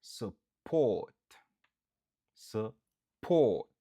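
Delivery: Support with port said loud; teacher drilling Support, accent on port; stress on port. Shown as a demonstration. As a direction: hit the port in Support hard. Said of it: "n ap aksantye sou dezyèm nan".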